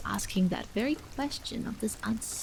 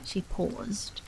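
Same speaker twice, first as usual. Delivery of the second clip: ordinary delivery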